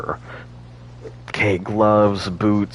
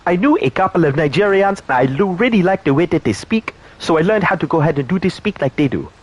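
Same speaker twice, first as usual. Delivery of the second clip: higher, put-on Nigerian accent; 7 semitones higher than usual. Shown as a demonstration. Streamer showing off a Nigerian accent he learned, higher. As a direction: speak like the Nigerians he knew. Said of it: "the Nigerian accent".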